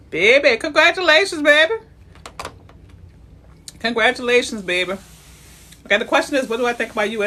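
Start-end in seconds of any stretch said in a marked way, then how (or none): 0.1-4.9 loud, high, happy cheer; excited congratulations. Real, not acted. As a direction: celebrate them warmly. Congratulating a home buyer.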